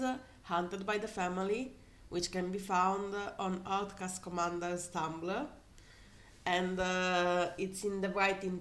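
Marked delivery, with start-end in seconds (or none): none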